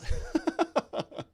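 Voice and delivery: breathy burst of giggles; real laugh. Real, not acted. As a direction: crack up at the request. Laughs at accent request.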